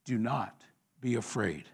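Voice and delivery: low, soft, measured comfort; hushed, earnest calm; solemn reassurance. Real, not acted. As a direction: quietly urge them not to fear. Preacher reassures congregation.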